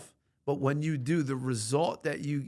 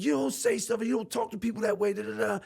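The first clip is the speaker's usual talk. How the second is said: higher, fast scolding mother; mom scolding; 7 semitones higher, faster than usual. Retold or imitated. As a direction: rattle off a mother's telling-off, pitched up. Mom scolding him in story.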